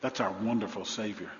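ordinary talk

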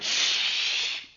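long, drawn-out "shh"; scolding crowd's shush; stern shush. Acted. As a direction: hush him firmly. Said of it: "the multitude sternly told him".

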